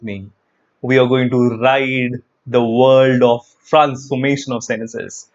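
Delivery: slow, dramatic ride announcer hype; mock pilot announcement, drawn out. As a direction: welcome them aboard like a thrill ride. Hyped roller-coaster style intro.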